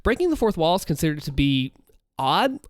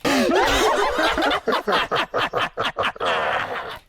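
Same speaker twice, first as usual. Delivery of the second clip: high breathy villain laughter; maniacal laugh; 12 semitones higher than usual. Acted. Villain laughing at the tricked viewer, high maniacal laughter. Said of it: "let's go for the next part".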